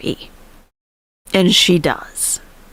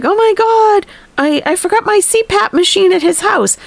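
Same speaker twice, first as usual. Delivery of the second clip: high-pitched panicked wail, retold; imitating her panic at a much higher pitch; mock freaking out; 12 semitones higher than usual. Streamer panicking over her forgotten machine, mock freak-out. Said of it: "she's freaking out".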